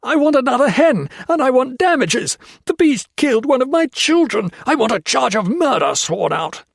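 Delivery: high, loud frantic screaming. A narrator's reading. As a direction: shriek it out like a madman. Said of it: "Foster was screaming".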